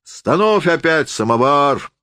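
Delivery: high, slow command; a host bellowing to put on the samovar; shouted order. A narrator's reading. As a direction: order the woman about. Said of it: "крикнул он".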